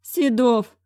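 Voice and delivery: put-on old headmaster dismayed; dismayed director. A narrator's reading. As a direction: recoil at the sight of him.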